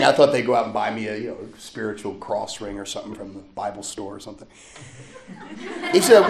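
ordinary talk, nothing marked

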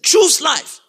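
high, ringing preacher's cry; emphatic command. Real, not acted. Preacher repeats what God says to congregation, emphatic high call. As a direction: hammer the command home.

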